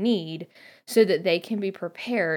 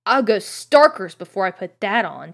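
mild stubborn insistence; stubbornly; 7 dB louder than usual. A narrator's reading. Ron refuses his mum's robes, mildly stubborn. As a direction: dig in your heels, lightly voiced. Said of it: "said Ron stubbornly".

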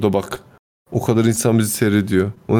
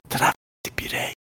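hushed punchline; 9 dB quieter than usual. Pretend. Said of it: "Gelip şey diyeceğim Murat".

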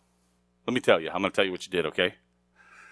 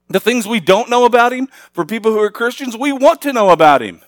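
higher and louder, pushed sermon voice; fiery preaching; 10 semitones higher, 6 dB louder than usual. Acted. Pastor preaching to the congregation, higher and louder. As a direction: preach it hard to the back row.

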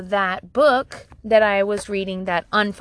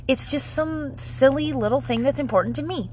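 ordinary talk

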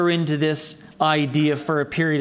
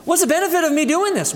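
fast, higher, scornful scoff; mock wicked scoff; 11 semitones higher, faster than usual. Preacher voicing the wicked for the brethren, a fast higher scoff. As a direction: toss it off quickly like it is a waste. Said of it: "that's how the wicked sound".

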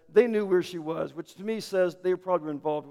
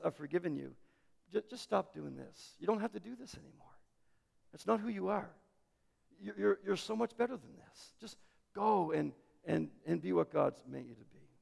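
tender plea; 10 dB quieter than usual. Retold or imitated. Jesus urging the forgiven woman, soft and partly whispered. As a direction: coax her gently toward better.

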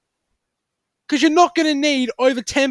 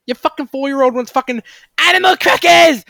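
loud exasperated rant; angry outburst; 5 semitones higher, 6 dB louder than usual. Real, not acted. Ranting at hoarding shoppers, a loud angry yell. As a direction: yell it at the panic buyers.

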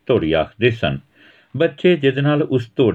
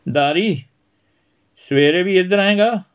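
put-on higher boyish voice, eager; boy friend asking; 11 semitones higher than usual. Dhira asking Dari if he'll come tomorrow, eager. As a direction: ask your pal hopefully.

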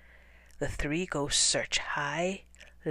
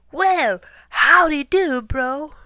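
high, loud cheery howdy; exclaimed greeting; 9 semitones higher, 12 dB louder, slower than usual. Acted. Tacky loudly greeting the biggest hunter, exclaimed. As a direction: belt out a friendly hello. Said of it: "and exclaimed".

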